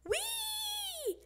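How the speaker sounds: very high, drawn-out squeal; long, soft, high whee; gleeful yell. A narrator's reading. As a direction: squeal high without much volume. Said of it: "threw up her hands and yelled".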